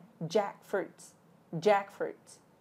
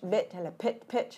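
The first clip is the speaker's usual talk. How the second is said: usual speaking voice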